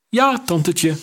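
high, impish reply; a cocky boy sassing his aunt; cheeky boy. A narrator's reading. Boy answering the queen with a cheeky grin.

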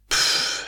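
an airless huff out; fed-up puff after the stuffy caravan; breathless puff. A narrator's reading. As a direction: blow out stale air with a pff. Kids come out of stuffy caravan.

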